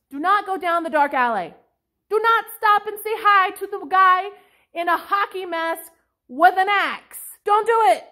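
loud, high, exasperated warning; urgent mock warning. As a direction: shout at a horror-film character to stop. Yelling warnings at horror film girls.

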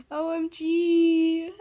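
drawn-out excited. Real, not acted. Spells it out thrilled.

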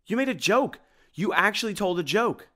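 high, loud astonished squeal; can't believe his ears; flabbergasted. Acted.

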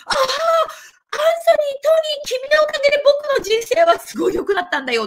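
very high-pitched gushing fan imitation; mock adoring fan crying out in gratitude; 感激したファンの真似. Acting out a grateful fan chasing Anthony. (甲高い声で感激するファンを演じる)

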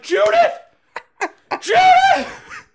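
hammed-up high, very loud shout of a name; over-the-top repeated name-yelling; imitated yelling. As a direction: yell the name in someone's face, mocking. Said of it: "He just keeps yelling her name at Brandy's face".

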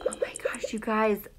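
hushed aside, all breath; whisper. Real, not acted. Teacher whispering excitedly to her online class.

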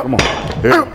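ordinary talk, nothing marked

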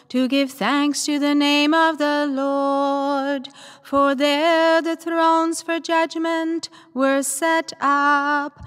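usual speaking voice

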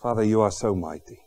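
soft, low prayer voice. Acted. Preacher leading the congregation in prayer, soft. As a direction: pray quietly and reverently.

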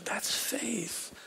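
That's faith, whispered softly with the stress on faith; hushed preacher's whisper. Real, not acted. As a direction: lean in and breathe it to the congregation.

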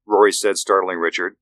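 usual speaking voice

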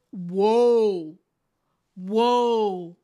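low, drawn-out whoa; slow, stunned whoa at the lie. Real, not acted. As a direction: let the shock sink in. Reacting to Garrett's lie.

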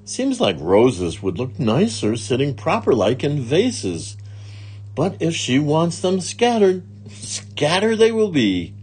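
lightly voiced literal-minded maid. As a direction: think it over as the obliging maid. Maid muses to herself.

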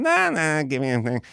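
waving it off; imitating Leno.

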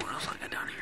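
soft unvoiced whisper. Real, not acted. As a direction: breathe it without voice. Whispered aside.

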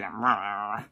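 low, soft little puppy grunt. Retold or imitated. The puppy's first noise in the car, low soft grunt. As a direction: imitate a tiny puppy's grunt. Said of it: "the puppy grunting noise".

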